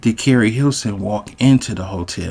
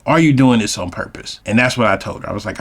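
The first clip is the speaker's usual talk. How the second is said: ordinary delivery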